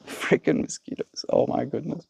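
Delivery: a grumbled curse to herself; whispered curse. Real, not acted. Streamer whispering a curse at the mosquitoes.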